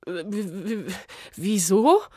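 nervous stammer on the w; stuttering. Acted. Caught witch stammering at the official, mild stutter. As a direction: stumble over the first sound. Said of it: "stotterte Smyrna".

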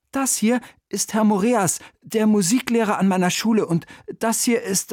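put-on high boyish introduction; a young boy presenting the guests. (hohe Jungenstimme)

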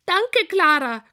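high, overjoyed piggy thanks; high, happy pig squeal. A narrator's reading. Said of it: "quiekt sie".

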